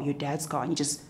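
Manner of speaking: normal talking voice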